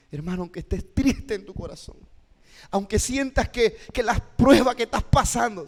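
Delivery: impassioned preacher's appeal, rising and building; moving intonation. Shown as a demonstration. Teacher shows how to move a hurting listener, impassioned. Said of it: "la entonación que estoy utilizando".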